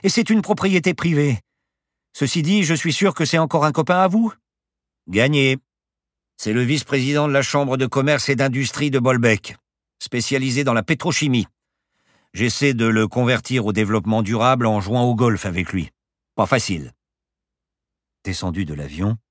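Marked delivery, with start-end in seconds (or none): 0.0-4.3 high, loud, accusing voice; exasperated woman making accusations; indignant passenger. Acted. Passenger scolds the pilot. 5.1-16.9 loud, higher, untroubled explanation; self-satisfied professor chatting about a friend; breezy pilot. Acted. Pilot blithely describes his friend.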